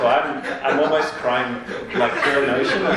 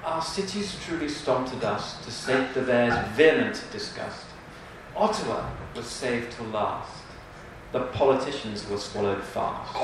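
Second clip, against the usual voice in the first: softer, solemn reading; a hint of hushed reverence; more reverential; 4 semitones lower, 6 dB quieter than usual. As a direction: hush it down with mock reverence. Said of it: "a bit more reverential for our capital".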